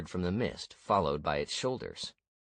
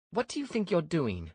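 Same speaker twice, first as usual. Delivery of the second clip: Ania's voice; 12 semitones higher than usual. A narrator's reading. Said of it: "Ania began".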